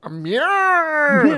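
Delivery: "mirror" stretched out long, high and loud; mock mispronunciation. Teasing a friend who can't say the word, mock mispronunciation. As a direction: draw out "mirror" in a loud, high mangled wail. Mocking how mirror is pronounced.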